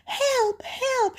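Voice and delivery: very high cries for help; grandmother's shout. Acted. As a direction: cry out shrilly high as a trapped granny. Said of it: "shouts grandmother".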